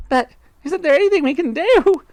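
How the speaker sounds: high, loud desperate plea; wife crying out. A narrator's reading. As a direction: beg shrilly for help. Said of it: "cried Miss Twit".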